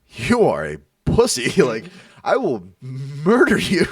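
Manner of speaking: slow, loud, drawn-out menace; mock-furious, heavily leaned-on threat; retold threat. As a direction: hammer each word, laugh breaking in.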